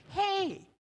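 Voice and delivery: a high, happy call of discovery; hey as if you just found something wonderful; delighted hey. Retold or imitated. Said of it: "in the way you would say "hey" when you discovered something wonderful".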